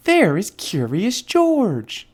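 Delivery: high, slow, hushed mother pointing; drawn-out, wondering mother to her girl. Acted. Woman showing her daughter Curious George, high and slow.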